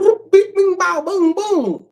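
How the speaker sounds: high boop bing bow boom sound effects; playful high-pitched bang noises; mock gun noises. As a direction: fire off cartoon shots up high. Imitated gunfire sounds.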